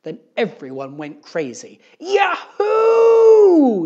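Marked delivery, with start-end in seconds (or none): 2.0-3.9 very high, long whooping cry; all-out shrieked cheer of joy; excited yell. A narrator's reading. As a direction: whoop at the top of your voice. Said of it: "yelled Cherie".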